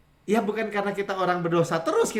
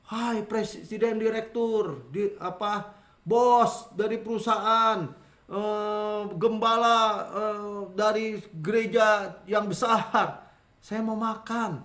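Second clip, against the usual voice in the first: slow, higher, stretched-out pompous titles; mock formal address; 3 semitones higher, slower than usual. Child greeting father at home by his job titles, mock formal. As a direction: labour through each grand title before the small request. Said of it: "Terus sampai rumah sama anaknya".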